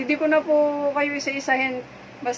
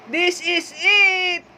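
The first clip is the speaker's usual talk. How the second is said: high, slow, drawn-out announcement; excited reveal; 6 semitones higher, much slower than usual. Real, not acted. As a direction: present the dish triumphantly, stretching the words. Presenting the celebration noodles. (sabik at pahabang pagpapakilala)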